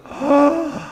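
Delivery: high, sudden dramatic gasp; mock gasp. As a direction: gasp like a startled dog owner. Your dog feels you going.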